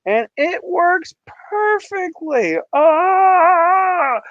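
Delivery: high, slow, thrilled exclamation; crowing over his own success; delighted. Real, not acted. To viewers, thrilled his tank setup works perfectly.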